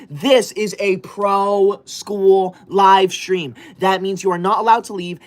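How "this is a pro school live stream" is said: very slow, each word weighed; slow rule announcement. Acted. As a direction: lay down the law slowly. Announcing stream rules.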